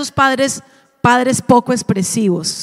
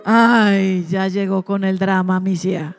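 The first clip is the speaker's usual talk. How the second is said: lower, scoffing husband's brush-off; husband waves off his crying wife, lower scoffing tone; dismissive husband; 5 semitones lower than usual. Retold or imitated. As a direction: roll your eyes at her tears. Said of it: "la esposa llora".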